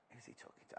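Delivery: retold whisper, breathy and hushed; whispered aside. Driver whispering suspiciously about a passenger on the phone. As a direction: hush it like a nosy driver. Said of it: "and I'm like".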